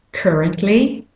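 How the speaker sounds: currently with American "er", rising; American take on currently, ending up like one of two options; American accent. Shown as a demonstration. Teacher contrasting accents, American accent on currently. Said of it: "if you're saying it with an American accent".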